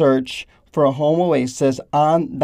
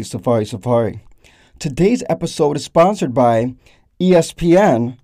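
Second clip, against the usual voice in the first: ordinary delivery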